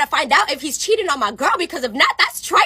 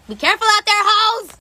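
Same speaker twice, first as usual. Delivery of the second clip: called-out warning; 8 semitones higher than usual. Acted. Storyteller warning the girls out there, hollering. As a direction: holler a comic warning out high. Closing warning repeated loudly to audience.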